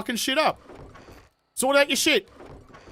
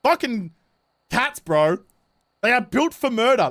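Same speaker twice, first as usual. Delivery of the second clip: ordinary delivery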